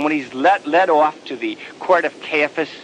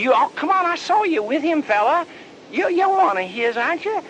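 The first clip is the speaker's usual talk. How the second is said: put-on high accusing girl.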